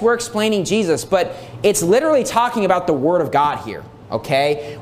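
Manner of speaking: ordinary talk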